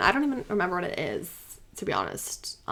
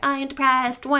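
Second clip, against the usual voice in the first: whiny mock girl voice, higher; mock her fake sulking; annoying girl voice; 5 semitones higher than usual. Streamer mocking Akane's tantrum, whiny girl voice. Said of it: "this annoying girl voice".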